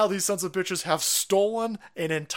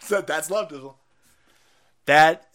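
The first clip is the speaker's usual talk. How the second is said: ordinary talk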